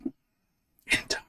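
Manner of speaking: soft raspy whisper of an ancient statue. Acted. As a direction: breathe it out like stone speaking. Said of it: "you hear a raspy voice emanate from both of these statues".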